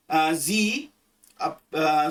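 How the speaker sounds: ordinary talk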